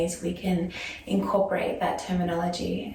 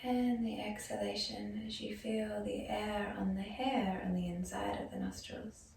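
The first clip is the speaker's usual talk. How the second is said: softer yoga nidra voice with long pauses; guided meditation; 10 dB quieter than usual. Acted. Yoga teacher guiding students into breath and body awareness, soft meditation voice. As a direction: ease them gently into stillness, leaving space after each phrase.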